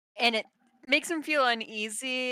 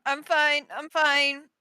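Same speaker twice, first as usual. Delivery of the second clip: flustered insistence; 4 semitones higher, 5 dB louder than usual. Acted. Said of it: "he's like".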